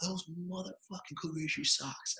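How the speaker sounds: high-pitched mock holler, kept soft; imitated coach's yell. Coach running over about the socks, muted mock yell. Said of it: "he's just yelling at somebody".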